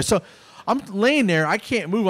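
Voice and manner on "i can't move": ordinary talk, nothing marked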